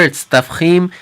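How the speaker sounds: normal talking voice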